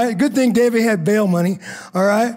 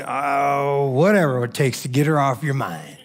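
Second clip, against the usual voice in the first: grudging agreement; 8 semitones lower, 3 dB quieter than usual. Retold or imitated. Husband grudgingly gives in to wife.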